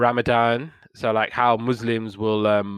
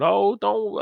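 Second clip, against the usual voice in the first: much higher mock-fretful mum voice; worried mum; 10 semitones higher than usual. Mums warning him not to jog at night, high fretful imitation. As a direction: imitate a mother fussing a warning.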